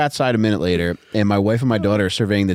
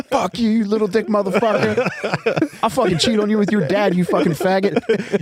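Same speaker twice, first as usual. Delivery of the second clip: higher, hammed-up furious wife; mock angry wife; 9 semitones higher than usual. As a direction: sneer it as a mock scorned wife. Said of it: "she'd be like".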